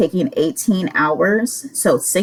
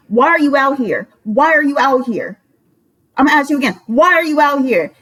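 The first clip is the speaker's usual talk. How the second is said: retold tirade; 4 semitones higher than usual. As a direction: hammer the repeated question like a fed-up roommate. Acted rant at sick roommate.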